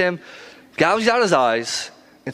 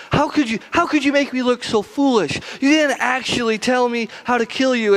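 much higher put-on voice, offended and whiny; indignant Delilah; 8 semitones higher than usual. Delilah complaining to Samson she looks foolish, high indignant voice. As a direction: play her as the wronged girlfriend, voice pitched way up. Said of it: "Delilah's like".

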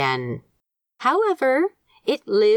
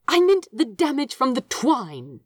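stern; 7 semitones higher than usual. A narrator's reading. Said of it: "said Aziraphale sternly".

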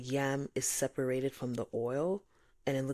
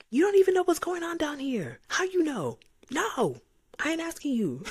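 much higher and louder, indignant; indignant outburst; 13 semitones higher, 5 dB louder than usual. Retold or imitated. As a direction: shout your complaint at the sky. Arguing with God, retold.

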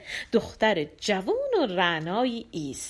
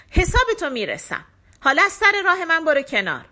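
raised, higher-pitched threatening voice; demon's angry threat; 6 semitones higher, 6 dB louder than usual. A narrator's reading. Demon ordering the river out of his way, angry threat. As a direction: threaten hotly, pushing the voice up.

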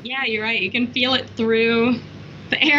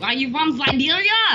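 questioning in a put-on Russian accent; mock Russian accent; 2 semitones higher than usual. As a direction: ask with a hammy Russian accent. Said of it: "Russian accent".

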